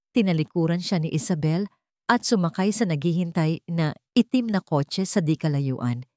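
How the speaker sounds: usual speaking voice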